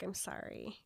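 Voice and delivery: very soft, tender apology; Bella's soft goodbye apology; hushed apology. Retold or imitated. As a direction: say it barely there. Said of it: "she's like".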